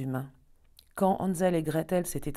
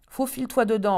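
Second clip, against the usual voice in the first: higher, louder witch order; commanding; 6 semitones higher, 5 dB louder than usual. Acted. Witch ordering Gretel into the oven, higher and louder. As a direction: snap the command. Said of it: "ordonna-t-elle".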